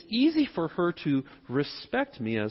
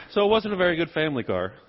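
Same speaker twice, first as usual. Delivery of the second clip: normal talking voice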